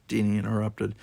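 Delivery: ordinary delivery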